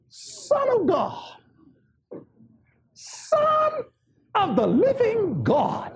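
slow, drawn-out cry of recognition; devils' cry. Acted. Devils recognizing Jesus before he asks, slow acted cry. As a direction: stretch it out high, then fall. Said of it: "they said".

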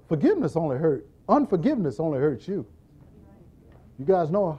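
normal talking voice

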